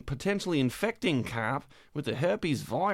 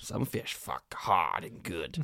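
deep comic voice; 8 semitones lower, 5 dB quieter, slower than usual. Acted.

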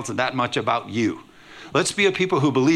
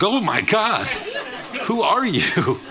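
shocked laughing surprise; 11 semitones higher, slower than usual. Pretend. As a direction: gasp at an unrecognizable face.

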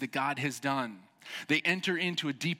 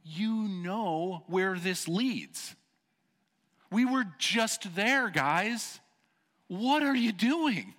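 high, slow exasperated scolding; a raised, pleading rebuke to a relapsing people; Nehemiah confronting; 7 semitones higher, 4 dB louder, much slower than usual. Retold or imitated. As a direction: plead with them like a fed-up leader. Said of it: "he's confronting them and he's saying".

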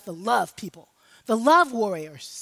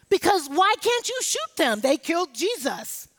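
ordinary delivery

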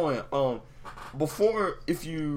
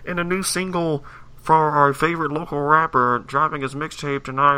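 radio announcer; 4 semitones higher, 7 dB louder than usual. Retold or imitated. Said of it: "you hear on the radio".

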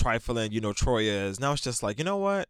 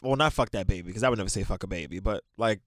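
normal talking voice

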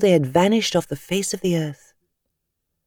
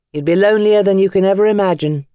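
louder, fast bitter warning; 5 semitones higher, 5 dB louder, faster than usual. Acted. Tom warning the cabbie about loneliness, louder and fast. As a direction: bite it out, speaking from grief.

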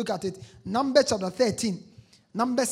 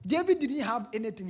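ordinary delivery

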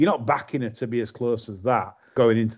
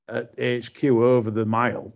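normal talking voice

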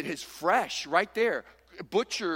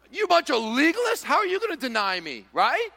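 mocking defiance; 10 semitones higher, 6 dB louder than usual. Mocking meat-eater defends himself.

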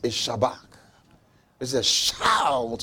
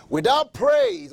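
Without praise slower and higher, praise hit hard; repeated Without praise, higher and louder; emphatic repeat; 11 semitones higher, 4 dB louder, slower than usual. Real, not acted. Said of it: "I'll repeat it again".

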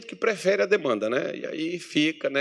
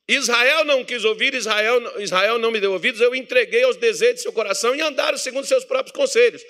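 higher, grave voice of God; God's voice; 7 semitones higher than usual. Acted. God rebukes his people Israel, grave and lamenting. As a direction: speak as God complaining of Israel.

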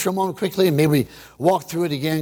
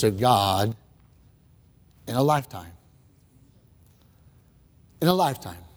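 solemn preaching; 8 semitones lower than usual. Acted. Preacher's slow solemn point.

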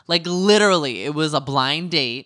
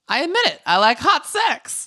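Ramona impression; 12 semitones higher, 4 dB louder than usual. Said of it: "as Ramona said in this episode".

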